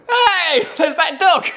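high, loud cry of recognition; high-pitched mock-thrilled shout; excited calling out. Excited cry quoting film moment.

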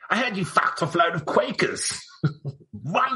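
high, laughing pompous governor voice; Lord Cornbury. Pretend. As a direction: gleefully play a snooty governor. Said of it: "Lord Cornbury here".

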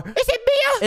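squeaky guess. Acted. Nico guessing name.

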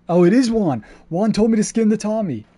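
loud, high exclamation of recognition; excited realization. Real, not acted. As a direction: burst out as the mystery is solved. Realizes who the sniper is.

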